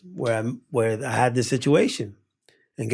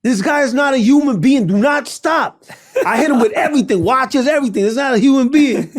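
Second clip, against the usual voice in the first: high, loud, hammed-up alarm; retold frantic warning; 10 semitones higher, 6 dB louder than usual. Warning friends he is not human, frantic mock yelling. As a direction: warn them off in mock panic.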